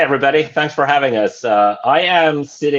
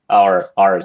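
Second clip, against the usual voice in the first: ordinary talk, nothing marked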